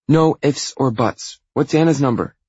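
loud, high-pitched demand; forceful younger-sister voice; bossy little sister. Acted. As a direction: insist loudly, pitch way up. Sister bossily demands the number.

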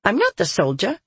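petulant voice. A narrator's reading. Voice shrugging off the soldier's question, petulant. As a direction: retort in a girlish voice. Childish voice retorting.

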